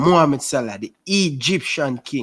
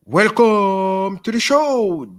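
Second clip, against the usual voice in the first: slow, higher showman welcome; host welcome; 6 semitones higher, slower than usual. Acted. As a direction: greet like a stage host. Hosting his post-match show.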